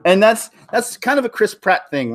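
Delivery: ordinary talk, nothing marked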